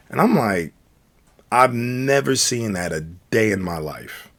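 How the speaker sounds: normal talking voice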